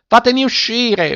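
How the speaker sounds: high-pitched yell of a trapped man; furious yell. A narrator's reading. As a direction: cry out like an enraged cat.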